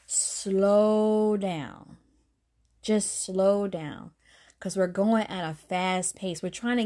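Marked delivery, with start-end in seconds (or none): 0.0-4.1 long, drawn-out words; slow, measured voice of God; God's slowing command. Retold or imitated. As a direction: stretch each word out slowly to calm someone rushing. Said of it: "And God is saying".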